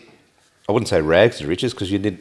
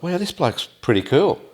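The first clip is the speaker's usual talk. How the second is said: higher, slower impressed musing; retold admiration; 7 semitones higher, 4 dB quieter than usual. Host recalling guest's achievements, slowly impressed. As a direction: lift it high and draw it out, impressed.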